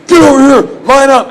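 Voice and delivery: loud, high shouted commands; barked orders. Retold or imitated. Melanie ordering everyone to the back, shouting. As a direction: yell like a Navy drill officer. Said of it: "'Cause she's from the Navy".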